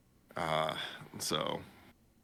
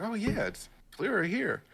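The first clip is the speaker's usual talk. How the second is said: raised, pleased voice; lightly voiced relieved man; 13 semitones higher than usual. The man pleased with the clean water, raised voice. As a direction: say it with happy relief. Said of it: "He's like".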